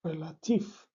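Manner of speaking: رلاتیف repeated slowly and clearly, stress on the last syllable; teacher modelling رلاتیف; careful model word. Shown as a demonstration. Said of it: "و این کلمه رلاتیف".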